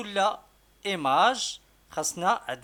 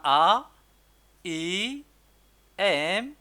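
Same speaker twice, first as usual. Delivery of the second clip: letters called out, climbing higher to إس; rising letter spelling; 4 semitones higher, 6 dB louder than usual. Shown as a demonstration. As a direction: spell each letter, rising toward the last. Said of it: "شكون هو الكلمة". (تهجئة الحروف بنبرة صاعدة)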